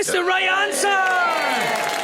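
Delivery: high, slow triumphant announcement; excited. Real, not acted. Host telling Melanie she got it right, triumphant and drawn out. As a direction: stretch it out like a big quiz reveal. Host confirms contestant's correct answer.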